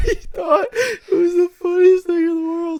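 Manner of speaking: very high, loud, near-crying laughter; helpless laughter. Real, not acted. Teasing him for wheezing at his own joke, helplessly laughing. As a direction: squeal the words out through tears of laughter. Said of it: "What are you guys both laughing so hard for?".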